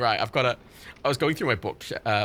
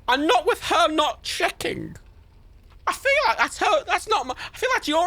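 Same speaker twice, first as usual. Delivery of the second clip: rising rant. Real, not acted. Ranting about the mother.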